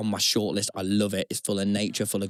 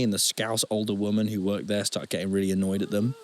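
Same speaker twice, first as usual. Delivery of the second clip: ordinary talk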